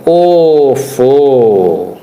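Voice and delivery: long drawn-out, low mock gasp of awe; mock admiration. Host reacts to the mother-daughter reel, mock admiring. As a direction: stretch it out, low and sarcastic.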